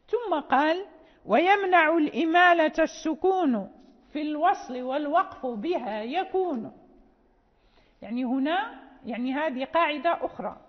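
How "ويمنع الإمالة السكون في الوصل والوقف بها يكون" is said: louder chanted opening of the verse; verse recitation. Shown as a demonstration. Teacher reciting the next rule of the poem, chanted. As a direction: announce the new rule in verse.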